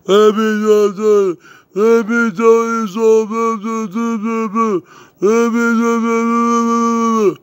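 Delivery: high, loud hyped-up catchphrase delivery; showing off how he always says his catchphrase; signature catchphrase voice. Shown as a demonstration. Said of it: "you know how I talk like this, folks? When I go".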